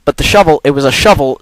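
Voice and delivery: ordinary talk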